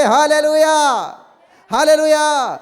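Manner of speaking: loud drawn-out hallelujah; preacher's ringing cry; fervent shout. Real, not acted. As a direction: call it out to the congregation.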